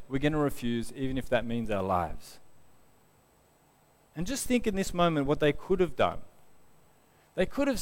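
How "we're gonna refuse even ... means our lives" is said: lower, softer, composed resolve; calm refusal. Retold or imitated. Three men refusing at cost of life, calm. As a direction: refuse calmly, letting the voice sink. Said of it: "they just calmly say".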